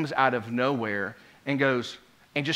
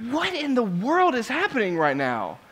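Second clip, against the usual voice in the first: disbelief; 7 semitones higher than usual. Retold or imitated. Preacher recalls his disbelief.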